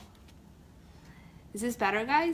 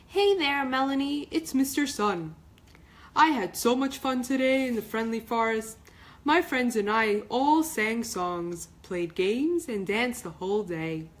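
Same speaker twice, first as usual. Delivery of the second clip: bright, louder, higher cheerful voice; beaming; 3 semitones higher, 5 dB louder than usual. Acted. Mister Sun telling Melanie about his fun day, beaming. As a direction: beam with joy as you tell it. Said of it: "He beamed".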